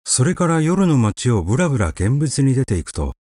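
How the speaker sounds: ordinary delivery, nothing marked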